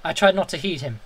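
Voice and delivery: usual speaking voice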